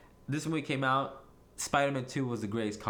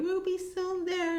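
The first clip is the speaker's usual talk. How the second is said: very high, drawn-out mocking voice; exaggerated falsetto imitation; mock excuse; slower than usual. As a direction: squeak it slowly as a put-on voice. Acting out the friends' excuse.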